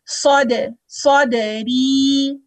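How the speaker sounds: सौद with a heavy, full saad, drawn out slowly; heavy saad recitation. Shown as a demonstration. Teacher showing students the heavy saad in सौदरी. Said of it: "ये जो सौद है ये मोटा है".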